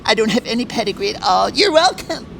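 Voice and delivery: hammed-up, high-pitched mimicry of a duchess; mocking Meghan impression. Host mocks Meghan boasting of her husband, high affected impression. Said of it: "unlike Meghan".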